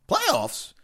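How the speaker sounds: "playoffs" yelped very high in disbelief; incredulous coach at a press conference; Jim Mora. Retold or imitated. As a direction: spit out "playoffs" like an exasperated coach. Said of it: "playoffs, Jim Mora".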